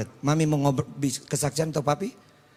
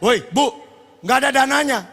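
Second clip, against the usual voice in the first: raised, higher mock call-out; mock yelling; 9 semitones higher, 7 dB louder than usual. ATM imagined yelling that funds are missing, mock shout. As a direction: pretend to holler it across the room. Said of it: "Kan nggak mungkin dia teriak-teriak".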